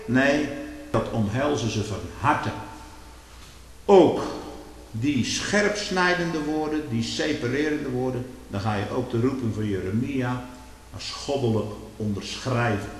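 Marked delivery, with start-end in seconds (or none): 0.1-8.2 loud, high, rising sermon fervour; fiery preaching. Acted. Preacher warning his congregation, preaching at full force. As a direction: thunder it from the pulpit.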